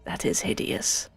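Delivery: whispered disgust. Real, not acted. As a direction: recoil in a low hushed voice. Disgusted at pet's eating.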